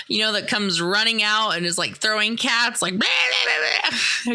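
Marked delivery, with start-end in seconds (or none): 3.0-4.3 high screeching gibberish yelled out; crazy cat lady. Retold or imitated. Narrator mimics the Simpsons cat lady throwing cats, screeching. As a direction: shriek nonsense like a mad cat lady. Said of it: "like the crazy cat lady from The Simpsons".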